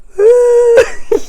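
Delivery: high, loud crying wail; high loud sob-laugh. Retold or imitated. Holding her newborn son, a high loud weepy cry.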